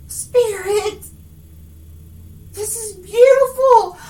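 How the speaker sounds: high, loud, near tears with joy; overwhelmed by beauty, crying out; moved outburst. Real, not acted. Reader to Spirit over the vision, tearful joyful outburst.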